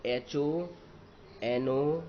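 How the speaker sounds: usual speaking voice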